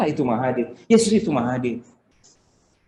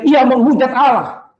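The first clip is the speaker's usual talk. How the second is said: high, loud, drawn-out accusation; imitated crowd shout, slow and high; shouting accuser; 9 semitones higher, 5 dB louder, slower than usual. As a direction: cry it out like the mob. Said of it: "Ketika orang teriak-teriak".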